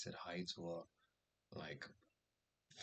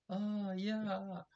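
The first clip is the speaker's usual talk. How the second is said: high, light imitated girls' "Oh, yeah"; girls' reply; 12 semitones higher than usual. The girls answering him, lightly imitated high.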